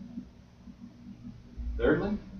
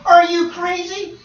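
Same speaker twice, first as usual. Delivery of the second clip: incredulous; 12 semitones higher, 5 dB louder, slower than usual. Acted. Voicing incredulous onlooker.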